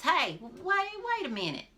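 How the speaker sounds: high loud call; yelled wait. A narrator's reading. The witch yells at Runwell to stop running. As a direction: yell after him to stop. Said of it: "She yelled at old Runwell".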